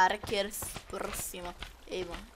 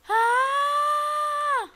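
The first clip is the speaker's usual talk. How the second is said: high, loud shriek of fright; startled scream; 12 dB louder than usual. Real, not acted. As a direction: scream as if jumped at. Jump scare in game. (grito de susto)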